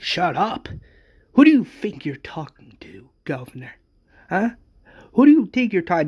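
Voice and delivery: loud, high bursts of affronted challenge; indignant. Acted. Wade confronts the governor over being silenced, indignant. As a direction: fire the question back, outraged.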